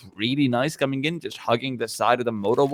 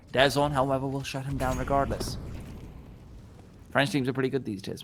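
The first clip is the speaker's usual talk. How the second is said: usual speaking voice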